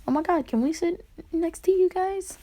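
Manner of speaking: put-on high-pitched, gushing girl voice; put-on excited fangirl voice; eager girls imitation. Voices two girls asking.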